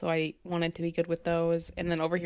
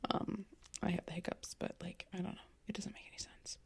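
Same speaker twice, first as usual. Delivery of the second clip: whispering; 12 dB quieter than usual. Real, not acted. Fading out while complaining.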